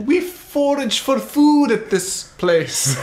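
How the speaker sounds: high, slow hammed-up Scandinavian accent; mock Scandinavian accent. Asked for Scandinavian, slow high mock accent. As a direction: drag it out like a bad Swedish chef. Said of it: "Norwegian or Scandinavian".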